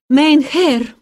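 gasping after skating hard; out of breath. A narrator's reading. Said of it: "задыхаясь".

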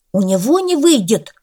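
the cat indignant at his friend's doubt. A narrator's reading. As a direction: protest hotly. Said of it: "возмутился Белый".

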